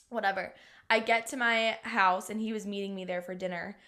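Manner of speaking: ordinary delivery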